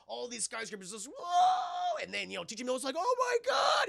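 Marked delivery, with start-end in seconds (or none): 1.1-2.0 very high, loud shout of shock; startled shout. Retold or imitated. Creature bursts through skyscrapers. 3.0-3.9 very high, loud cry of disbelief; shocked shout. Retold or imitated. Watching creature attack.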